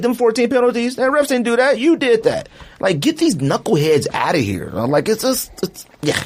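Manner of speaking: ordinary talk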